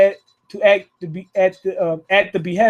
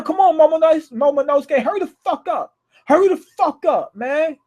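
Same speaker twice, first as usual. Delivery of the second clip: excited cheering; 6 semitones higher than usual. Real, not acted. Fan yelling at Momonosuke to hurry and save Zoe, excited.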